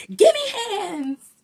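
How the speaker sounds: put-on high loud kids' shout; shrill mock-scared shout. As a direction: yell the warning as you flee. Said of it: "They ran off shouting".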